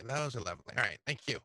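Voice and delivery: ordinary talk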